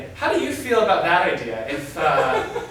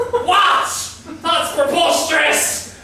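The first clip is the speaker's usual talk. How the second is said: put-on indignant British accent, higher and louder; 9 semitones higher, 4 dB louder, slower than usual. Boss hearing his intern is dating his daughter, outraged British accent. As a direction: play a scandalised British gent. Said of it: "All of a sudden I have a British accent".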